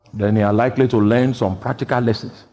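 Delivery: ordinary talk, nothing marked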